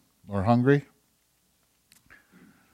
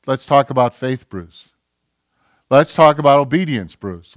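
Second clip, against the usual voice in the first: firm, knowing call to Bruce; God gently pressing Bruce; a light firm divine voice; 2 semitones higher, 6 dB louder than usual. Retold or imitated. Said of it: "God looks and says".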